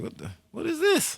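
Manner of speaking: very high, squeaky disbelief; retold shock at a song; stunned disbelief. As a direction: shoot way up in pitch, baffled. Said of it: "I'm like".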